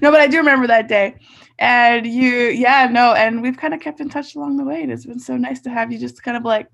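ordinary talk, nothing marked

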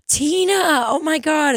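high, thrilled greeting of a friend; excited greeting. Retold or imitated. Rich couple greeting their manager with delight. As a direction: squeal her name with delight.

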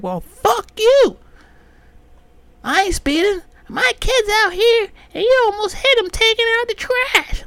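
high, loud, slow shrill nagging woman; mock nagging neighbor woman. Neighbor woman scolding a speeding driver, high mocking voice. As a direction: mimic the annoyed neighbor lady. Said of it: "I was like".

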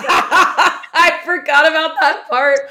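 laughing as she remembers. Real, not acted. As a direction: say it through real laughter. Laughing at shared memory.

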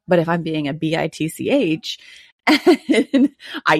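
letters spelled one by one, with a laugh; spelling it out. Shown as a demonstration. Mom spells a swear word for little kids listening, laughing. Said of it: "I'm gonna spell it in case any of you have littles in the car".

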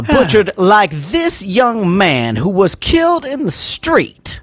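put-on Southern accent with grand lawyerly drama; mock Southern lawyer. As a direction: plead to the jury in a hammed-up Southern drawl. Plays courtroom lawyer, Southern accent.

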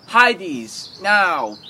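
high, loud, drawn-out order; mock command. Retelling a film to a friend, hammed-up slow shouted order.

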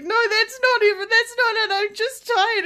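high, loud brushing it off; high breezy denial. Retold or imitated. Stabbed worker brushing off her colleague, high breezy denial. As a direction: wave it away. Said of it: "And I was like".

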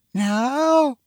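put-on high-pitched, surprised child's voice; young son. Son answers from upstairs, high and puzzled. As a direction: question it in a high little voice.

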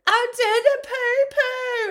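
put-on high, loud, drawn-out toddler wail; crying toddler. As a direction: wail it out slowly like a distraught small child.

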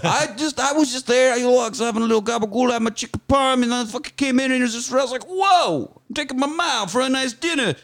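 high, hammed-up New Jersey mobster; New York mobster. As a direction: play a Jersey wiseguy. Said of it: "All right, Tony".

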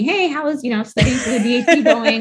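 high, laughing mock of nagging parents; teasing parents. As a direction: ask it like a parent pretending not to nag, with a laugh. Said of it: "my parents would ask me".

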